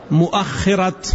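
"مؤخرة" as mu-'akh-khira, hamza with fatha; open hamza. Shown as a demonstration. Teacher listing another dialect form, "مؤخرة" pronounced mu-'akh-khira. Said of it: "بفتح الهمزة".

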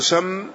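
سم with the seen opened to a short "a"; fatha on سم. Shown as a demonstration. Teacher listing the word's three vowellings, سم said "samm". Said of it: "فبفتح السين وضمها وكسرها".

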